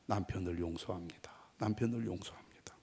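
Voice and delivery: very soft, low murmur; hushed private repetition; inner confession. Retold or imitated. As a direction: say it under the breath, as if only in the heart. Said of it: "마음속으로 계속 말했습니다".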